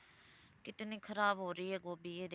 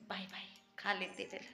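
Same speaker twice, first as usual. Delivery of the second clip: whispered aside; 3 dB quieter than usual. Real, not acted. Aside while sister is busy.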